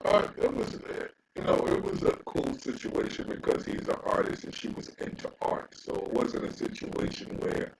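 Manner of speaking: faint, muffled, distorted mic; muffled bad mic. Real, not acted. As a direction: voice through a broken mic. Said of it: "your mic is really bad".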